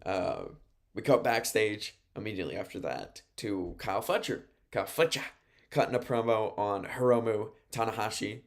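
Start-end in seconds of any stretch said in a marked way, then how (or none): none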